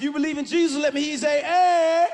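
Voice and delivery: rally the audience to shout back; crowd call. Real, not acted. Comic hyping the crowd about Jesus, rising to a shout. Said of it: "call-and-response with audience".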